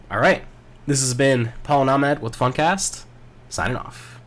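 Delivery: upbeat host sign-off; podcast sign-off. Acted. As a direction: wrap up the show with energy. Signing off the podcast.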